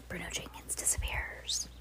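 hushed, breathy whisper; a spooky whispered aside. Shown as a demonstration. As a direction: whisper it like a secret. Repeats line, then whispers it.

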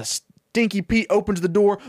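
usual speaking voice

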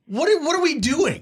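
very high-pitched, indignant question; retold outburst of frustration; exasperated. Humiliated player challenges the friend mid-game, exasperated. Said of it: "I was so angry".

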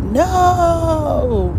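high mock shout of no; crowd shouting no. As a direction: yell no back like the whole crowd.

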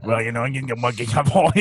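thick Cajun mumble impression; garbled Cajun coach voice; Farmer Fran. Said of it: "sounds exactly like Farmer Fran".

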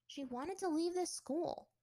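usual speaking voice

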